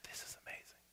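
moved father whispering at the wedding; awed whisper. Real, not acted. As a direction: breathe it in awe through tears.

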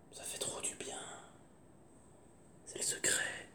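soft secret whisper. Real, not acted. Confiding secret.

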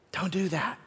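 hushed, gentle warning; soft warning. Pretend. God whispering a warning to a struggling listener, hushed. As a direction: say it softly, like a quiet caution. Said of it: "wants to whisper warnings to you".

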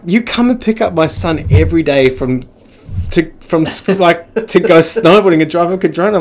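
loud, high, incredulous scolding; retold telling-off. Cop mum to her son's unlicensed mate, mock scolding. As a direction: imitate an exasperated mum laying into a kid.

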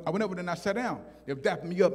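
ordinary talk, nothing marked

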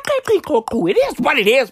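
ordinary delivery

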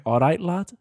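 high, loud Liverpool "All right, lad"; Scouse accent. Retold or imitated. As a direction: greet him like a cheery Scouser. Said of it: "Doctor Sid Watkins is from Liverpool".

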